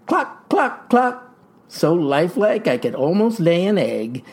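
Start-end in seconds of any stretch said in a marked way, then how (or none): 0.1-1.3 high clucking like a hen; hammed-up hen clucking; hen clucks. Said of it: "And the hen".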